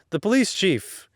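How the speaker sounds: usual speaking voice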